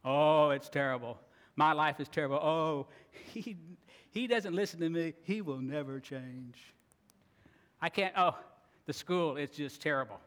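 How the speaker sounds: whiny complaining voice, lightly hammed; a hint of a self-pitying whiner; mock grumbling. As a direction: moan about life like a grumbler. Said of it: "We start grumbling".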